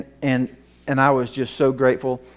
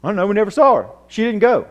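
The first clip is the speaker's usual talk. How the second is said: put-on higher, louder boyish protest; boy's denial; 10 semitones higher, 10 dB louder, faster than usual. Son telling his mother he never saw his sister, higher louder protest. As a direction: play the son insisting he never saw her. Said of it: "And he said".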